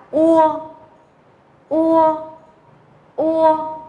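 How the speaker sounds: "Ua" held high and level, u gliding to o; model final sound. Shown as a demonstration. Teacher shows how UO is read, "Ua" drawn out. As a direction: stretch each "Ua" evenly on a high pitch. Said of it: "U O chúng ta đọc là".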